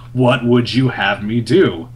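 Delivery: slow, firm Magneto reply; insistent repeated question. Retold or imitated. Magneto repeating himself to Quicksilver, firmly and slowly. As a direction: project it slowly, word by word. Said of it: "Magneto repeats even louder".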